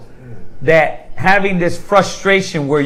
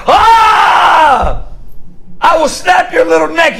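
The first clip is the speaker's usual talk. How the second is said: fed-up outburst; 10 semitones higher, slower than usual. Retold or imitated. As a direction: blow up at the pestering kid. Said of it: "You're like".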